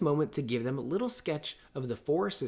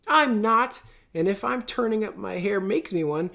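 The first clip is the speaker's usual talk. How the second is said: higher and louder, a defiant outburst; crying out; 9 semitones higher, 8 dB louder than usual. Acted. Joe protesting to Meg about growing up, loud and defiant. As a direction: fling it back in protest. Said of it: "cried Joe".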